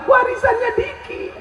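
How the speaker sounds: very high hammed-up whine; mock whining widow. Widow grumbling about the inheritance, very high. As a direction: complain shrilly about the inheritance. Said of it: "Kau nangis kenapa kuat?".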